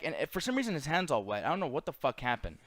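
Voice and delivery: ordinary talk, nothing marked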